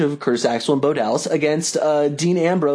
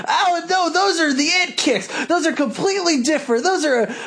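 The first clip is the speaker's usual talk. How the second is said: much higher, whiny protest; Corey Graves impression; 10 semitones higher than usual. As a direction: whine like a heel commentator. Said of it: "Corey was doing his".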